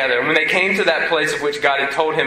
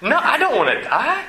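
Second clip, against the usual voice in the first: much higher, laughing mock terror; hammed-up frightened boy pleading; panicked Isaac; 9 semitones higher than usual. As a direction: squeal it out in comic panic. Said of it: "Isaac's like".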